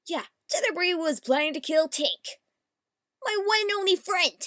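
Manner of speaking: high, loud outraged cry; indignant shout. Acted. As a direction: shriek in betrayed fury. Indignant character outburst.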